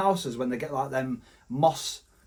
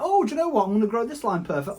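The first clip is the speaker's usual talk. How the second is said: much higher, fast silly voice; squeaky mock voice; 5 semitones higher, faster than usual. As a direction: squeak it out like a cartoon. Voicing nature, higher.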